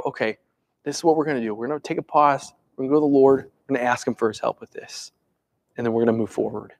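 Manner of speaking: low, soft, steady voice; calm leader. Retold or imitated. Calm leader steadying a panicking room, low and soft. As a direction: settle everyone down quietly. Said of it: "they go".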